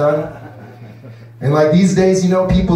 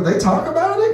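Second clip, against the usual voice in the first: mock concerned; 14 semitones higher than usual. His reply.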